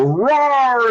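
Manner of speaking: high, drawn-out mock roar; monster roar. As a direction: roar like a fake monster on a slab. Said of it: "rise up and go".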